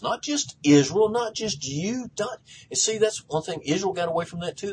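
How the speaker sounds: high, driving pulpit proclamation; impassioned preaching. Real, not acted. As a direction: preach it with building repeated phrases. Preaches at full force.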